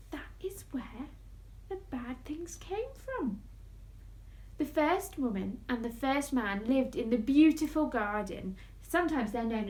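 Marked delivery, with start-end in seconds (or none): 0.1-3.4 hushed solemn moral; whispering. A narrator's reading. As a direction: let it sink in softly.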